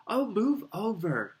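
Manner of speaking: high, loud, drawn-out impatience; snarling. A narrator's reading. Hermione taking over at the locked door, snarling. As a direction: shove them aside with an exasperated snarl. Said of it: "Hermione snarled".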